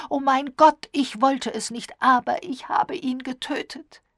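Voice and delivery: high, loud, anguished outburst; wild cry. A narrator's reading. Diana confesses before fainting, anguished cry. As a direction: cry out in horror at your own deed. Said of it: "rief sie".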